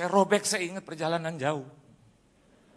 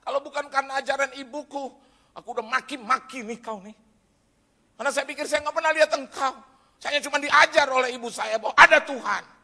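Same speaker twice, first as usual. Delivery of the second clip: raised, higher voice arguing with God; heated complaint; 6 semitones higher, 10 dB louder than usual. Retold or imitated. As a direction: protest loudly and bitterly to heaven. Retold angry complaint to God.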